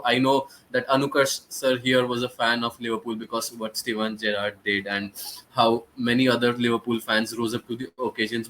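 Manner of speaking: usual speaking voice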